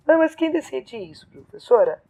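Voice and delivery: a hint of a student interrupting with a doubt; student's question. Student asks the teacher who decides this, questioning.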